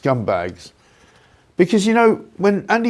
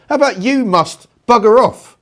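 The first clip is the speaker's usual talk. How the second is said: loud, higher scornful jab; angry retort; 8 semitones higher, 6 dB louder than usual. Real, not acted. Host telling Burnham to get lost, loud and scornful. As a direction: spit it back at him mockingly.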